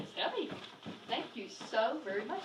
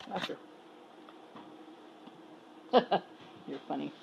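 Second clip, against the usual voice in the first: normal talking voice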